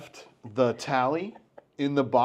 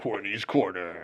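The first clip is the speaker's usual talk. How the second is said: horror host; 4 semitones lower, slower than usual. Acted. Repeating segment name.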